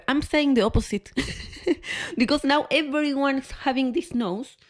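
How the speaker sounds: ordinary talk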